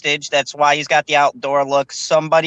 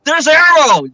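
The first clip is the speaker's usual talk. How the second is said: much higher and louder, snapping at a customer; exasperated yell; 10 semitones higher, 5 dB louder than usual. Retold or imitated. Agitated guard yelling at a lost shopper, high and loud. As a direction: bark directions like you've lost patience. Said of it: "I'll be like".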